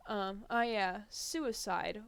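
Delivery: ordinary talk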